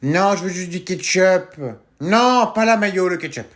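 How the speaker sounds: put-on loud kid yelling to mum in the next room; shouting across the house. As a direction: call out loud to someone far away. Kid shouting to mother in another room.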